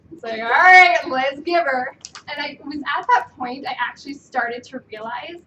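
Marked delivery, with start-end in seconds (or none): 0.4-2.0 loud, fired-up go-ahead; pumped up. Retold or imitated. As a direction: call it like a team cheer. Retold pumped decision.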